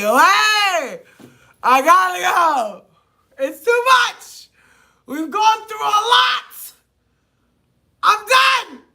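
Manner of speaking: helpless screaming laugh fit; real hysterical shrieks of laughter. Real, not acted. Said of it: "I'm so sorry to scream".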